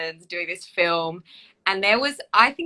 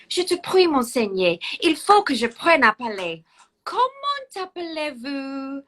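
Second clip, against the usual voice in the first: princess voice, higher, slowing on the question; Princess Catherine in French; 5 semitones higher, 4 dB louder than usual. Acted. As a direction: back to the eager princess learning English. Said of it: "I was totally doing both parts then".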